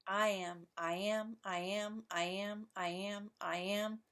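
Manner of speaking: steady looping I AM, I AM; repeated iambic beat. Shown as a demonstration. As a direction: chant I am evenly, leaning on AM. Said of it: "over and over and over again".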